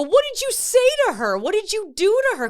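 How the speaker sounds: high, loud demanding parents; alarmed parents. Retold or imitated. Parents rushing in to their crying daughter, alarmed and loud. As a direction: confront the doctor, alarmed and loud. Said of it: "And they go".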